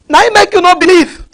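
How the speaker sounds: preacher laughing at sceptics, mocking jab; laughing mockery. Real, not acted. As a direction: laugh at them as you mock.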